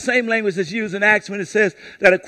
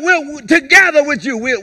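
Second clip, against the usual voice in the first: normal talking voice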